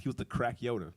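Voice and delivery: usual speaking voice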